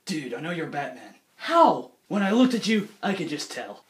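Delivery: low, deadpan exchange; lightly voiced cop and Batman; mock Batman dialogue. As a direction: play both sides low. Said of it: "he's like".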